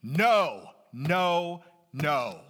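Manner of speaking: three loud, pounding no's; Paul's emphatic no. Retold or imitated. Preacher voicing Paul's refusal, loud and emphatic. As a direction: bang each no down hard. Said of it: "I'm gonna bang around a bit here".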